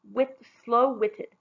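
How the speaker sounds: usual speaking voice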